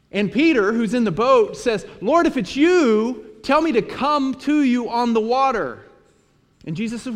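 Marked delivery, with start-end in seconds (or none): 2.0-5.8 high, loud call across the water. Acted. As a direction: shout it from the boat, slow at first. Peter calls to Jesus.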